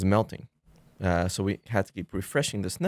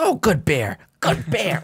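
praising bear; 8 semitones higher, 5 dB louder, slower than usual. Retold or imitated.

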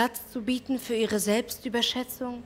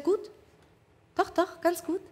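higher and softer, brushing it off; a quiet, higher voice insisting she's fine; evasive woman; 5 semitones higher, 5 dB quieter than usual. Acted. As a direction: play her hiding sadness behind "gut". She downplays her trouble.

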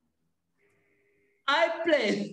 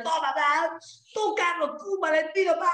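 throwing tantrum; 6 semitones higher than usual. Pretend. Girl throwing things at classmates.

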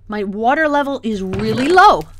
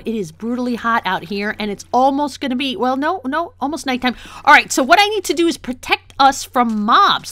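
usual speaking voice

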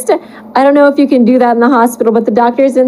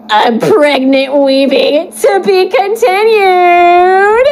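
dramatic reveal; 7 semitones higher, much slower than usual. Acted.

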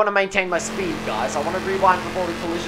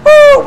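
high, loud "woo!"; excited whoop; 16 dB louder than usual. Real, not acted. Gamer making it through the inside, excited whoop. Celebrates a move while gaming.

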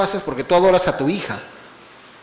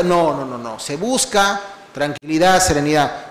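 normal talking voice

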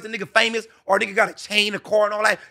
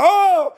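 sudden high, loud mock cry of "Oh"; mock moan; 9 semitones higher, 6 dB louder than usual. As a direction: yelp it out like the woman, high and loud. Woman voiced mid-sex.

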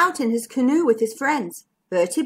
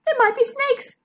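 scared. Acted. Bertie scared.

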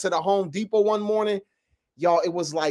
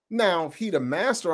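usual speaking voice

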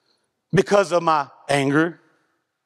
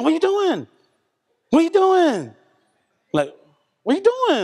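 high, sharp mock nag from the passenger seat; wife nagging; 11 semitones higher than usual. Wife nagging her husband from the passenger seat, high and sharp. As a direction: play the wife snapping at the driver. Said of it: "she would do this thing".

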